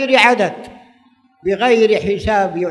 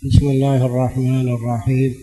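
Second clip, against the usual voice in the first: measured formal opening invocation; formal standard arabic; 4 semitones lower than usual. Acted. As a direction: recite the opening formula solemnly, lower.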